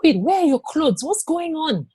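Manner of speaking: put-on a startled woman seeing a naked child at her door; shocked neighbor.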